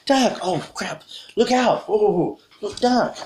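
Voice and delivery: high, panicky warnings; acted burst of alarm; alarmed shouting. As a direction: play sudden danger, pitched right up. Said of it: "you could show alarm".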